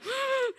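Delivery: sharp high gasp; startled gasp. Retold or imitated. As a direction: gasp in surprise. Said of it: "they're like".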